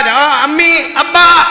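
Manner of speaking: loud careless call to parents on entering; calling out loudly. Retold or imitated. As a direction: shout it across the house as you walk in. Young man barging in home. (زور سے بےپروا پکار)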